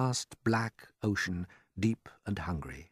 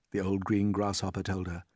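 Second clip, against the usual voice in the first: ordinary delivery, nothing marked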